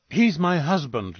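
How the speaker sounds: slow, pointed snap at the brother-in-law; a wife defending her husband with a bite; mild narrated snap; snapped. A narrator's reading. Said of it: "Louise snapped at him".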